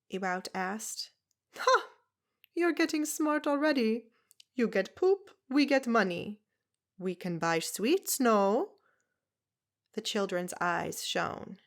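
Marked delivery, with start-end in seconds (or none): 1.6-8.7 put-on higher, loud foreign accent, scornful; a tiny old foreigner scoffing at washerwomen; brusque foreign woman. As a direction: Zelda mocking the town's washing, loud and brusque. Zelda's voice held.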